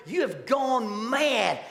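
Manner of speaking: a governor shouting down a prisoner; Festus yelling. Retold or imitated. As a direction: bellow it over the defense. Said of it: "Festus yells over him".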